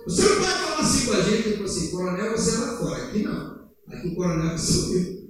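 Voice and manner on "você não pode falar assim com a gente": raised, higher protest; mock-indignant colonel objecting. Colonel standing up to protest at the meeting, raised indignant voice. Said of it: "o coronel se levantou".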